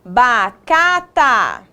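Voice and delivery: "bacata" very slow, each syllable stretched; sounding out. Shown as a demonstration. Teacher testing made-up word.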